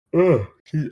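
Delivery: talking through a yawn; words stretched by a yawn. Real, not acted. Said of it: "I keep yawning".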